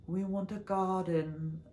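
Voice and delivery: high, slow mock-British couple; badly faked British accent, drawn out; poor British accent. Imitating cute couples on a garden show, poor British accent. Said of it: "I can't really do a British accent".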